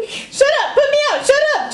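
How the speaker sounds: high frantic shriek at the plants; panicked scream. Acted. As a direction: screech it like you're on fire. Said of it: "Charlie screamed".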